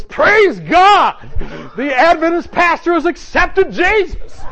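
high, loud, slow preacher's cry of triumph; jubilant preacher shout. Retold or imitated. Baptist pastor announcing a convert to the packed church, a triumphant shout. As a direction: shout it over the whole congregation. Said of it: "and the pastor goes".

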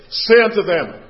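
loud, slow, weighty Bible reading; preacher solemnly proclaiming God's words; emphatic scripture reading. Acted. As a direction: declaim the verse, leaning on key words. Reads scripture with preaching force.

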